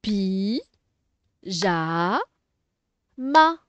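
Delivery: "Pi, ja, ma" in three drawn-out, separated beats; syllable by syllable. Shown as a demonstration. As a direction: leave a long gap after each part of "Pi, ja, ma". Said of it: "on va séparer les syllabes".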